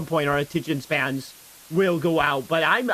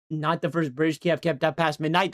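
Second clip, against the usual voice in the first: ordinary delivery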